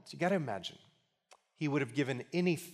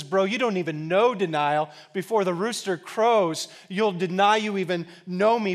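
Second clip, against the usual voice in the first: loud, raised-pitch mock comeback; emphatic rebuttal; 6 semitones higher, 8 dB louder than usual. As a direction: push back forcefully, acting out the reply. Jesus rebuts Peter, retold.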